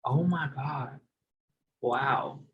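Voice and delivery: normal talking voice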